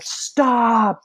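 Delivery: high, loud drawn-out stop; retold alarmed yell. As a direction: yell it like an instructor halting a student. Instructor jumps up, retold yelling stop.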